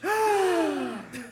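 sharp group gasp of astonishment; shocked gasp. Acted. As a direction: gasp in high surprise. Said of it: "There is a gasp of astonishment".

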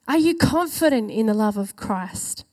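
usual speaking voice

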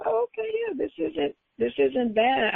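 high, relieved oh okay; mild mock surprise at ease; lightly acted relief; retold pleasant surprise.

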